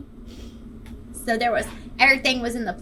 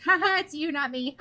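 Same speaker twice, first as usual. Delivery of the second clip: high, teasing gloat; mocking laugh; 5 semitones higher than usual. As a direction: imitate a friend laughing at you. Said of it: "she laughed at me and was like".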